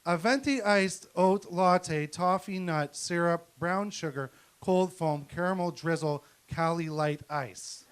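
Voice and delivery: slow, deliberate order reading. Retold or imitated. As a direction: read the order out slowly. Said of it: "your stepdaughter would like".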